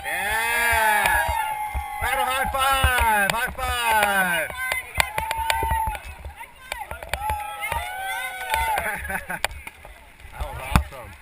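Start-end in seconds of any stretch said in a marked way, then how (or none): 0.0-3.7 loud, high victory whoop; excited cheering. Real, not acted. Cheering after running the rapid. 3.8-10.3 big breathless laugh of relief; exhilarated high laugh. Real, not acted. Laughing after the rapid.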